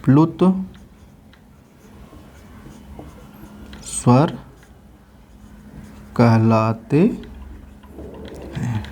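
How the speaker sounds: each word spaced out with long pauses; slow dictation. Acted. Teacher dictates the definition to students slowly.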